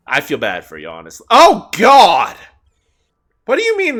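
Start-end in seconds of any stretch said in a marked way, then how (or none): 1.3-4.0 loud startled cry; startled shout. Real, not acted. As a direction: yell in sudden alarm. Sudden game reaction.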